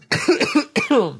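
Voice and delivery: choking cough; sputtering cough after swallowing wrong; coughing up water. Real, not acted. That went down the wrong pipe.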